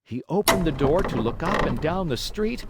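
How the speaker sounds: usual speaking voice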